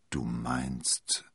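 low, tentative half-question; hesitant. A narrator's reading. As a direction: feel your way into it hesitantly. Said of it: "sagte Athos zögernd".

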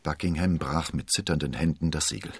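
usual speaking voice

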